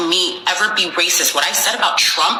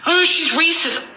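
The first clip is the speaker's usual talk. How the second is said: mock commenter; 11 semitones higher than usual. Mocking internet commenters' accusation.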